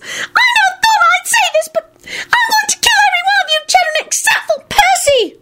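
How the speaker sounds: very high squealed threat; tiny piping voice. A narrator's reading. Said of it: "squealed in his tiny piping voice".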